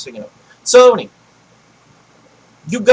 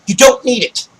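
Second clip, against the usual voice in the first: ordinary delivery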